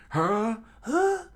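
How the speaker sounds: loud, very high falsetto yelps; shocked townspeople. Acted. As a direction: yelp it in startled disbelief.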